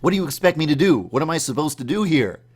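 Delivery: loud, high, fast fluster; panicked confusion. Retold or imitated. Dreamer dropped into a war, loud fast panic. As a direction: blurt out the panic, lost and overwhelmed. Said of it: "but I'm like".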